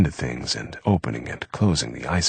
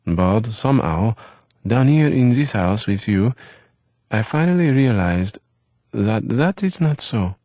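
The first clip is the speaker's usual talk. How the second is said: lightly voiced young French boy, higher; Eve's voice; 6 semitones higher than usual. A narrator's reading. Eve to Eric about dinner, lightly voiced boy. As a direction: Eve brightly telling Eric the plans.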